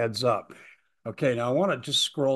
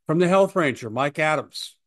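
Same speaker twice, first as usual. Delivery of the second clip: usual speaking voice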